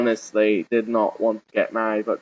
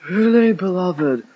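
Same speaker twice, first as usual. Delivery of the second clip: louder, higher, drawn-out archbishop's address; hint of a rasping officiant, slow and raised; rasped; 6 semitones higher, 5 dB louder, slower than usual. A narrator's reading. Archbishop opening the wedding ceremony, rasped and drawn out. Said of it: "rasped the Archbishop of Canto".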